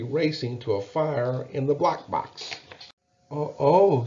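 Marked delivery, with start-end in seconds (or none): 3.3-4.0 small voice. A narrator's reading. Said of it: "said a small voice".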